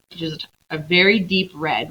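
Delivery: usual speaking voice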